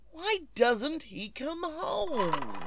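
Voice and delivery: slow, trembling words breaking into an uneasy laugh; a frightened invalid laughing at her own nerves; nervous laugh. Acted. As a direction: laugh off rising fear. Anxious wife alone, waiting.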